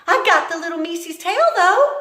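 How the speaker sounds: high, loud boast; Mig proud. Acted. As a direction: hold up the tail proudly. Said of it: "said Mig".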